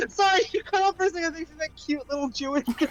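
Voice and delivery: high, fast words through real laughter; laughing hard. Real, not acted. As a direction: get it out while cracking up. Cracking up at a misheard word.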